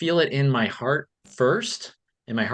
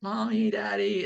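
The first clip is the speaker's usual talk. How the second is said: put-on higher, small child voice; timid little kid; 7 semitones higher than usual. Boy peeking out to call his parents, small and timid.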